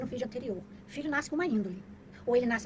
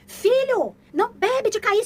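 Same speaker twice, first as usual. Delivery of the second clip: much higher, loud mock order; mock nagging parent; 10 semitones higher, 12 dB louder, much slower than usual. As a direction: nag like a hypocrite parent. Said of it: "O que que adianta você falar".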